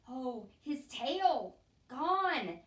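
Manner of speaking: loud, high, slow horrified exclaim; shocked cry. A narrator's reading. Mouse finding his tail gone, a loud horrified cry. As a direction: gasp it out in horror.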